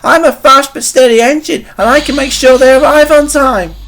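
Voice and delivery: high, loud perky voice; volunteer keenly in a high, proud voice; eager Stepney. A narrator's reading. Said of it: "he suggested".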